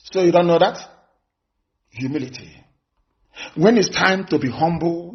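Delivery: ordinary talk, nothing marked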